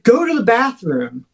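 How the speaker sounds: mock yell, ordering the dogs off; retold yelling. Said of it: "so I'm yelling at them".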